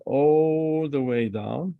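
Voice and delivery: "all" stretched very long in "all the way down"; slow, elongated "all", then finish the phrase; drawn-out emphasis. Real, not acted. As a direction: hold the vowel of "all" as a line is drawn. Drawing a long line while teaching.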